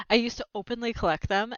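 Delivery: plain reading voice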